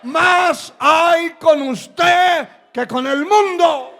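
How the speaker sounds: slow, high, booming shout; impassioned preaching. Real, not acted. Preacher assuring believers they outnumber the world, full-force preaching shout. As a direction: hurl each phrase out to the congregation.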